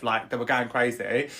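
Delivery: ordinary delivery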